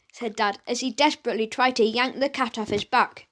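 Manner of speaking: usual speaking voice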